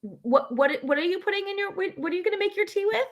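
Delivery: high, put-on questioning voice; puzzled questioner. Teasing friend asking what goes in tea, high mock puzzlement. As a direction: imitate someone asking, pitched well up.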